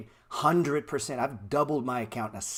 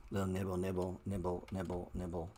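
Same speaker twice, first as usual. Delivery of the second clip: soft, lowered little nibble voice fading out; tiny nibbling; 4 semitones lower, 7 dB quieter than usual. Pretend. Explaining nibbling to viewers, soft mock nibble voice.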